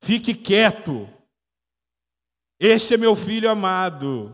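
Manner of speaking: booming divine rebuke; stern, angry voice. Pretend. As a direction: thunder it out slowly, sternly. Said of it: "foi uma voz meio brava".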